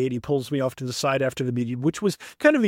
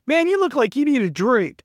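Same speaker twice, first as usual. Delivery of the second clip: friendly stranger quote; 9 semitones higher, 4 dB louder than usual. Retold or imitated. Stranger offering a drink.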